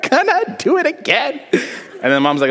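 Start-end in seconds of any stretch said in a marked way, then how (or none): 0.1-1.4 very high fake wail; hammed-up crying in feigned pain; pretending to hurt. Child during a beating in padded pants, wailing in fake pain. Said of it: "you pretend like you're hurting".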